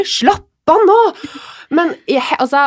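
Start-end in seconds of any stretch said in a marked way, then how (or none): 0.0-1.0 high, loud mock dad snap; dad snapping. Dad to panicking wife and daughter, a high loud snap. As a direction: bark it at the panicking family. Dad telling family to calm.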